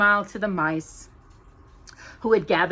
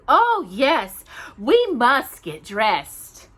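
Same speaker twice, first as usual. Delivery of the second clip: joyful; 5 semitones higher, 7 dB louder, much slower than usual. Acted. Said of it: "Cinderella said joyfully".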